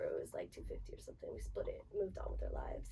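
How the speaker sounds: whispered aside. Real, not acted. Trails off into near whisper.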